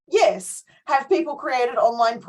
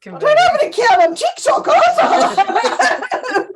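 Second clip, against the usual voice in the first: laughing, higher, louder, slow; 13 semitones higher, 9 dB louder, slower than usual. Real, not acted. Host laughing at her own TikTok mix-up, laughing loud and high. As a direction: confess it while cracking up.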